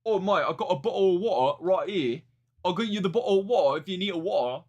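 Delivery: exaggerated British accent, mocking. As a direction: parody a rapper's fake British accent. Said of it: "we better drop the British accent".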